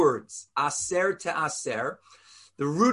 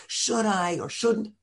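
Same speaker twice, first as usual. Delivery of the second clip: slow, higher-pitched dithering; mock hand-wringing; 4 semitones higher, slower than usual. Rabbi mimics an anxious giver torn over writing a check, mock dithering. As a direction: act out agonizing indecision, slowly. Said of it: "wringing of my hands".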